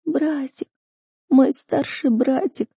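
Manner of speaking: put-on high, slow, small girl's cry; weeping. A narrator's reading. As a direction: whimper for a brother in a high voice. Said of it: "плач девушки выворачивал душу наизнанку". (плачущий, высокий, протяжный девичий голос)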